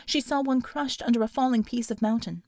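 usual speaking voice

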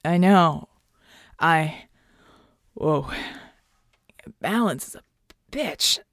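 groggy, halting slur; dazed and losing words; slurred, stammering. A narrator's reading. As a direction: break it up with woozy pauses. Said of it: "his words beginning to slur".

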